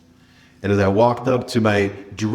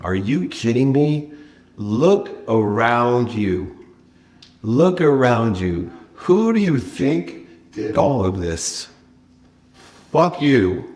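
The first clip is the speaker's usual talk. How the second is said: acted arrogant outburst; ignorant arrogance; 3 semitones higher, slower than usual. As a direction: lord it over them, stretching the words slowly. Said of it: "No better display of ignorant arrogance".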